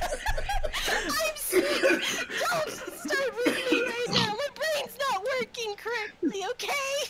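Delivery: words breaking up with real laughter; pleading through giggles, can't keep it together; laughing while talking. Real, not acted. Flustered over price mixup, laughing.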